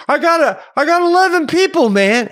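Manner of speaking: high, loud mock holler; defensive yell. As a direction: imitate a stranger justifying himself loudly. Stranger yells back.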